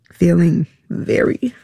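halting, fighting back tears; real tearful struggle. Real, not acted. Admits her child felt betrayed.

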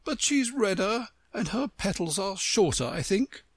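high-voiced, judging flower; candid talking Rose. Rose compares the newcomer with Alice, candidly. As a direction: describe tactlessly in a high voice.

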